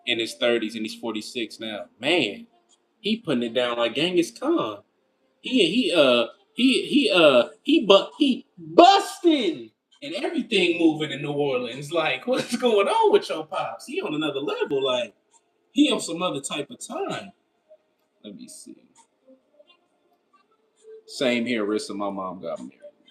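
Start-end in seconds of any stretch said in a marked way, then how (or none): none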